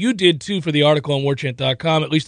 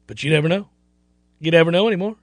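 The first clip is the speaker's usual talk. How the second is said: usual speaking voice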